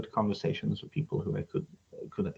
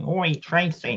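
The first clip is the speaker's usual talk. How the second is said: mock southern English accent, pitched up; 9 semitones higher, slower than usual. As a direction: toss off a quick southern English "Oi, Tracy". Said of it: "like, the southern accent".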